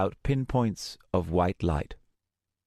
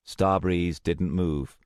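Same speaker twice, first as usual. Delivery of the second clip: ordinary delivery, nothing marked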